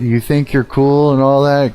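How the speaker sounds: usual speaking voice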